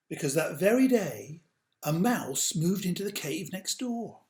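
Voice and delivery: put-on slow, dramatic read-aloud for children; storybook narration. A narrator's reading. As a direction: tell it like a bedtime tale, big and grand.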